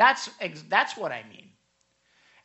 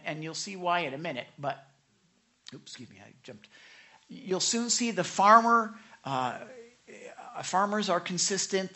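normal talking voice

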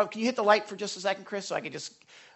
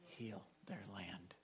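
slow, much softer and lower, almost gone; hushed reward; 10 semitones lower, 24 dB quieter, slower than usual. Shown as a demonstration. Pastor reading God's reward to the church, hushed and slow. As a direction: drop to a hush as the verse ends. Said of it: "listen to the reward".

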